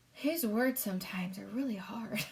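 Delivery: soft unvoiced whisper off the page; quiet whispered remark to the listener; whispered aside. Real, not acted. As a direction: lean in and whisper the comment. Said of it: "aside whispered".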